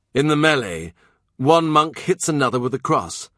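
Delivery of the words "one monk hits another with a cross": ordinary delivery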